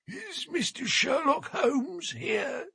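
put-on high, slow, frail old seaman asking; a frail old sailor at the door; old sailor's voice. A narrator's reading. Old sailor asks for Holmes at the door, frail and high.

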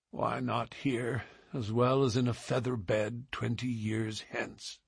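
resigned murmur under the breath; muttered. A narrator's reading. Dying wanderer mutters to himself, soft and resigned. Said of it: "he muttered".

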